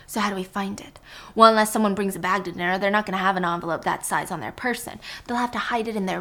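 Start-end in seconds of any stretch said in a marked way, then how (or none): 0.1-1.0 whisper. Acted. Helen whispering a plan.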